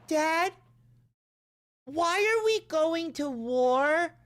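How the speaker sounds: put-on high, slow, childlike question; little kid voice. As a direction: play the six-year-old asking his dad. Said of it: "six years old watching my dad".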